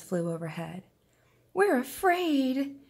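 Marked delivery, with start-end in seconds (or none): 1.5-2.8 frightened fish. Acted. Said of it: "cried the fish".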